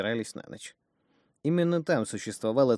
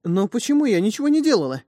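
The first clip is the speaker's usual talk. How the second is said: frightened; 13 semitones higher, 11 dB louder than usual. Acted. Terrified woman pleads.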